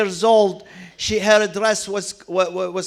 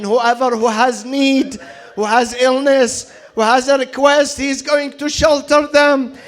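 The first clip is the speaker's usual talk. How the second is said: climbing higher and higher as the plea builds; an impassioned plea that keeps rising; rising sermon fervour; 5 semitones higher than usual. Acted. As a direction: preach it with growing passion. Preacher voicing girl, building fervour.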